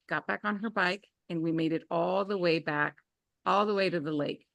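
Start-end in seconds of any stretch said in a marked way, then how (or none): none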